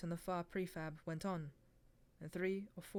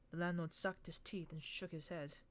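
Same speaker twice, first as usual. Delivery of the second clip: usual speaking voice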